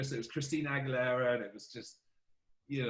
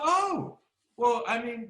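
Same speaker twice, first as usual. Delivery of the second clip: amazed oh; 10 semitones higher, 4 dB louder, slower than usual. Retold or imitated. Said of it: "And I was like".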